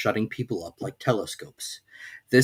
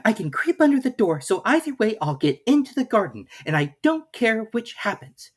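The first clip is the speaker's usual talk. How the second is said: put-on much higher girlish reasoning; a girl talking herself into it; Alice's voice; 11 semitones higher than usual. A narrator's reading. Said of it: "said Alice".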